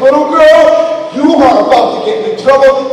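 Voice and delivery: very loud, high-pitched threat; retold parental outburst; angry dad warning. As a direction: scold her at full volume. Dad warning misbehaving daughter.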